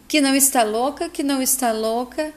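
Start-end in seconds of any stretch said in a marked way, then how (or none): none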